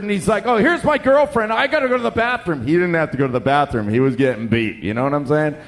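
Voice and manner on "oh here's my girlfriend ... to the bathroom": high, hurried mock fluster. Young man escaping a losing argument, acted flustered. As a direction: blurt out an excuse, pitched up. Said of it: "this guy gets flustered".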